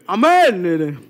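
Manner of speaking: a loud churchgoer's amen; booming mock amen; loudest amen. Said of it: "tipe-tipe amin paling kenceng ini".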